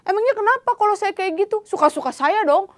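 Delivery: put-on a child snapping back when scolded; mock indignant outburst; angry comeback. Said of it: "balik marah".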